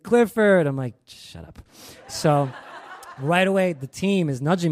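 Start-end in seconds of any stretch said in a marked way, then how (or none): 0.0-0.7 high, louder mock call. Security guard spotting Clifford, calling out. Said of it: "He's like". 1.1-1.6 very soft, unvoiced aside; muttered retort. Costumed worker to security guard, whispered retort.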